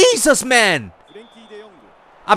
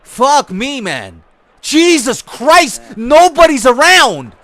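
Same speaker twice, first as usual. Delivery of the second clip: slow, loud and higher; louder drawn-out exasperation; exasperated yell; 4 semitones higher, 8 dB louder, much slower than usual. Real, not acted. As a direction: drag it out in disbelief. Exasperated at the game.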